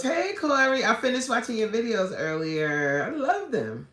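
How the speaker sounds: high, delighted hello to a fan; excited greeting. Real, not acted. Streamer welcoming a viewer whose videos she loved, excited. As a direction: greet them with a high, lifted lilt.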